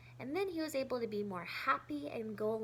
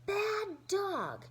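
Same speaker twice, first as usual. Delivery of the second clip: almost scolding; 9 semitones higher than usual. Acted. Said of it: "he almost scolded".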